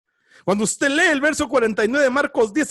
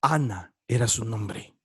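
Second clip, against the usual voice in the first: low solemn aside; 14 semitones lower, 6 dB quieter than usual. Acted.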